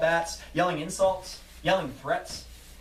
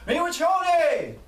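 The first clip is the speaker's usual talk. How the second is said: long, high, loud rallying call; yelled cry; 13 semitones higher, 6 dB louder, slower than usual. Acted. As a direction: call it out slowly across the line. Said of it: "Another water protector yells".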